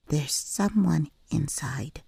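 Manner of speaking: put-on slow little-girl whisper. A narrator's reading. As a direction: hush it, scared of being heard.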